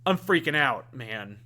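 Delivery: understated freak-out, dropping off on "man"; Harry freaking out. Acted. Host acting out Harry's version, a subdued freak-out. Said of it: "or it's Harry, and he's like".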